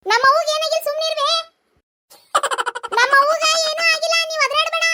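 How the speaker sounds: put-on high childish voice hushing grandma. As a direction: play the small granddaughter. Child character voice.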